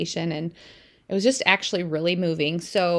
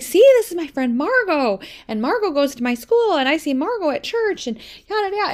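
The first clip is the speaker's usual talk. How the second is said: put-on much higher, louder little-girl chatter; excited child; 9 semitones higher, 6 dB louder than usual. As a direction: gush like a proud little kid. Child explains to dad.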